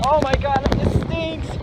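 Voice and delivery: shouting over the storm wind; loud pained yell. Real, not acted. Pelted by rain outdoors.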